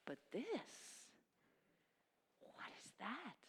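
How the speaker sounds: soft whispered wonder; whispered amazement; hushed awe. Acted. Awed question about holiness.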